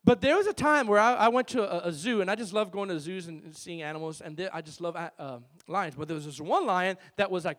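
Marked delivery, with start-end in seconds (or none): none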